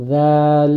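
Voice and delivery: drawn-out "জাল" with tongue tip touching upper teeth; "জাল" opening on a voiced TH, held long; dental dhal. Shown as a demonstration. Teacher modelling the letter dhal, lisped and held. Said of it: "ওভাবেই উচ্চারণ হবে".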